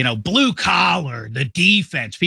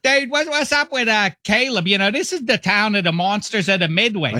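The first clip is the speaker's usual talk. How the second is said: put-on high, brash Pittsburgh-accented buddy; mock Pittsburgh-accent rant; Pittsburgh accent as Chicago guy; 7 semitones higher than usual. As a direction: sound like a Pittsburgh guy playing a Chicago fan. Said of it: "This is Pittsburgh doing a Chicago guy".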